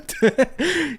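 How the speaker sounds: high bright burst of laughter; light laugh. Real, not acted. Reader laughs at her own job joke, high laugh.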